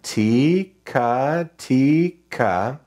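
"Tika tika" drawn out, one syllable at a time; slow, spaced-out rhythm syllables. Shown as a demonstration. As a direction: stretch each syllable as if writing it. Sounding out while drawing each note.

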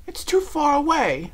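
high, slow strained voice; annoyed effort; frustrated. A narrator's reading. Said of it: "said Rarity in frustration".

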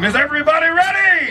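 high, loud call to the crowd; rally the crowd even harder; shouted question. Real, not acted. Host asks the crowd a second time, shouting. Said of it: "Let's try it one more time".

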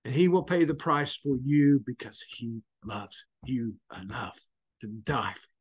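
low, soft and sincere, drawing them close; tender hush. Real, not acted. Preacher to his congregation about Christ's love, tender and hushed.

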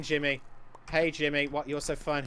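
ordinary delivery

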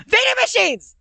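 high, excited yell; excited shout. Real, not acted. Player spotting the vending machines, excited shout. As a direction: call it out high in sudden excitement.

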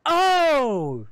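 high, loud, long falling oh; relieved. Acted. Friend hearing guard's reassurance, hugely relieved. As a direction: let it all out in relief. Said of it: "heaves a breath of relief".

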